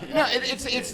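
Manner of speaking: normal talking voice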